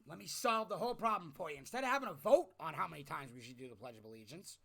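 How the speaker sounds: normal talking voice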